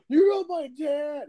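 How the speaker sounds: put-on high, loud, drawn-out kid protest; mock mad kid. Teased kid insisting to the fake daddy, mock mad. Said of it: "He got so mad".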